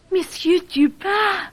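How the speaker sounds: gasp, then a high, slow question; startled at the famous name; gasped surprise. Acted. Yvette startled to meet the famous detective, gasping.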